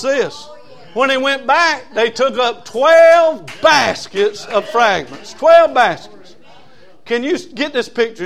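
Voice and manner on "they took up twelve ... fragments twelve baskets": slow and loud, leaning hard on "twelve"; stress on twelve. Acted. Preacher booming "twelve" slowly to the congregation. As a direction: hammer "twelve baskets" home, drawn out.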